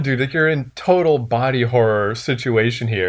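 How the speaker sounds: ordinary talk, nothing marked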